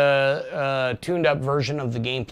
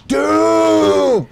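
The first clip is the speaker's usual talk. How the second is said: high, drawn-out full-throated shout; roaring it out at the top of the voice; yelling; 12 semitones higher, 9 dB louder, much slower than usual. Real, not acted. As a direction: bellow each word, stretching the last one long. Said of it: "why I'm fucking yelling here".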